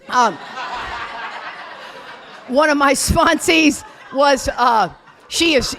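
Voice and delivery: loud laughing, slowed talk. Real, not acted. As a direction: push words through laughter. Laughing while starting story.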